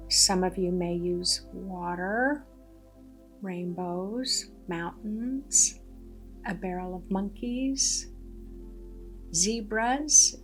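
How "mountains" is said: usual speaking voice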